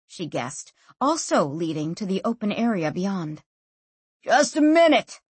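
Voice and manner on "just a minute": shouted high and loud; angry shout. A narrator's reading. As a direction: bark it out, irritated. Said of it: "shouted the man, somewhat angrily".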